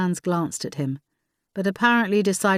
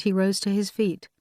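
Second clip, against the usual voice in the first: usual speaking voice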